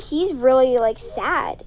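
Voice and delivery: ordinary talk, nothing marked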